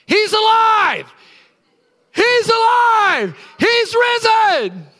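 high, loud, slow triumphant shout; he's alive, shouted; joyful shout. Retold or imitated. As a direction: cry it out with overflowing joy. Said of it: "I told all those quiet people".

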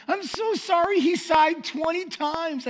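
high, loud, drawn-out mock apology; apologetic wife. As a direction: play up a wife's mortified confession. Wife apologizing again by phone.

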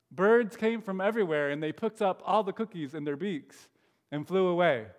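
put-on lively read-aloud for children; animated story narration; storybook reading. A narrator's reading. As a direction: read it like a bedtime picture book.

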